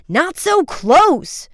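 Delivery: high, loud, slow furious warning; angry shout. A narrator's reading. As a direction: yell angrily at the other pilot. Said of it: "Frank shouted angrily".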